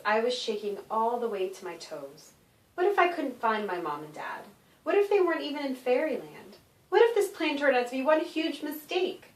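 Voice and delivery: plain reading voice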